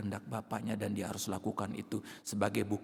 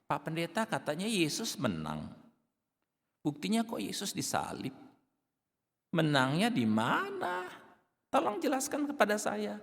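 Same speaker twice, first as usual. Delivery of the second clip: high, sneering and taunting; mock the pastor with a jeering tone; higher-pitched scornful questioner; 9 semitones higher than usual. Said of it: "dengan sikap yang rasanya ingin menertawakan".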